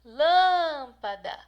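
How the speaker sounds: loud, long-drawn "Lâmpada", stretched on LÂM; calling out. Shown as a demonstration. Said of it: "vai chamar como se a lâmpada fosse alguém". (chamando alto "Lâmpada", esticando o LÂM)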